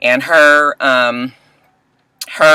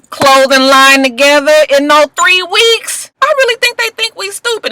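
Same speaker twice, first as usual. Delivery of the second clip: exasperated; 11 semitones higher than usual. Real, not acted. Ranting at the show.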